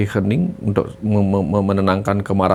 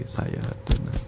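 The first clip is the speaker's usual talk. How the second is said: slow, much softer, breathed whisper; calming whisper; 9 dB quieter, slower than usual. Shown as a demonstration. Coach showing a viewer calming self-talk, slow whisper. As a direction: whisper it to calm the body. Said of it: "beri perintah kepada tubuh untuk menenangkan dirinya".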